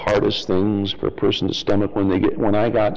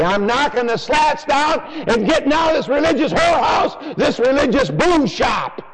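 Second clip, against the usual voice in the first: high shouted Luther quote; shouting Luther. Retold or imitated. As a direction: bellow it as Luther breaking out. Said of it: "And he'd say".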